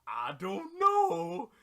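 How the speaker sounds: slow, loud, dopey drawl; dopey dragon. Pretend. Dragon shrugging off Oolong's wish, slow dopey reply. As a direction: draw it out like a dim dragon. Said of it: "dragon replies".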